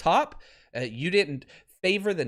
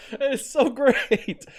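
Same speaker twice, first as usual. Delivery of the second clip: high, drawn-out words through laughter; real delighted laughing; 8 semitones higher, much slower than usual. Real, not acted. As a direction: crack up with delight while speaking. Cracks up after the played clip.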